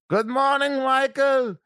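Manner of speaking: high, sing-song French-ish hello; French accent greeting. Retold or imitated. Jeannot greeting Michael in the morning, French accent. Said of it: "or whatever his accent was".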